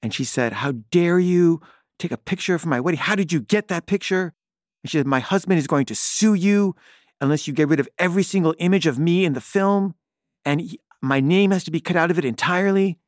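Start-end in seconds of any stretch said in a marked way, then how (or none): 0.5-4.3 high-pitched outrage, imitating an upset friend; mock yell, raised in pitch but not volume; retold yelling. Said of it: "she was yelling at me". 5.1-13.0 high, indignant threat, imitating an upset friend; retold hysteria. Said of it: "she was so hysterical".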